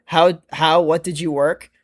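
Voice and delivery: ordinary talk, nothing marked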